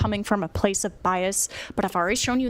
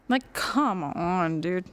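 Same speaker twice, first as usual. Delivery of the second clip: slow, dragged-out exasperation; slow exasperated drawl; disgusted drawl; 2 semitones lower, much slower than usual. Real, not acted. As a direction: stretch it out in disbelief. Exasperated drawn-out repeat.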